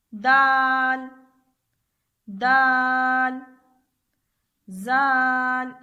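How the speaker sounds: "दाल" and "जाल" with the long vowel held out slowly; drawn-out vowel. Shown as a demonstration. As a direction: hold the vowel of "दाल" and "जाल" for three alifs. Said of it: "दाल और जाल के ऊपर भी मद है".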